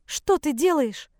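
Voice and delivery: high, drawn-out alarmed demand; flaring up. Acted. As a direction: flare up at your son in alarm. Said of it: "взвилась мать". (вскинувшись, высоким голосом)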